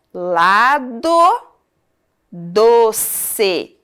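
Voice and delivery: LADO and DOCE slowly split as LA-DO, DO-CE; syllable by syllable. Shown as a demonstration. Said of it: "A sílaba final desta palavra".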